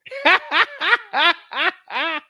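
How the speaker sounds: high burst of laughter; cracking up; big laugh. Real, not acted. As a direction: laugh out loud at the chat. Reading chat, laughing hard.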